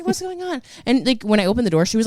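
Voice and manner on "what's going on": startled squeak. Retold or imitated. Retells same startled reaction.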